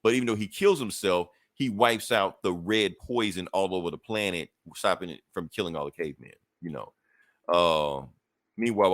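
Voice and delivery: usual speaking voice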